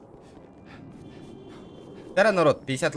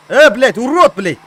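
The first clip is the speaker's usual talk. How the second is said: furious yelled curse; angry shout; 7 semitones higher, 16 dB louder, slower than usual. Real, not acted. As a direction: shout insults at him. Cursing at another player.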